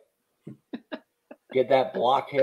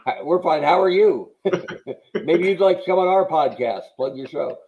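smug fake cheer; 5 semitones higher, 5 dB louder than usual. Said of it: "continues the mocked rivals".

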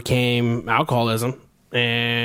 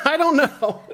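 high laughing imitation of her line; mocking echo; 11 semitones higher than usual. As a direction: mimic her unsure answer with a laugh. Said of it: "She said".

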